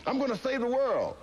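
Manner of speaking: very high, strained proclamation; ecstatic shout. Retold or imitated. Fighter shouting down his trainers, ecstatic. Continued delirious screaming.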